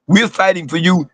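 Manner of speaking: ordinary talk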